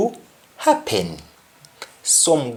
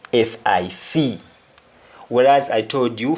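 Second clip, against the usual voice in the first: ordinary delivery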